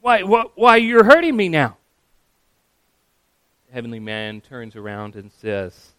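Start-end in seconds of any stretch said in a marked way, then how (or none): 0.0-1.8 loud, high, stammering protest; hurt, high-pitched outcry; acted scream. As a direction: cry out in pain, tripping on the first word. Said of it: "The man with the lizard screams".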